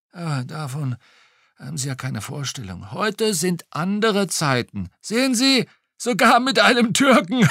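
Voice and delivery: a hint of a gossiping retired policeman; old policeman voice. A narrator's reading. Retired cop chatting to the detective over lunch, lightly voiced.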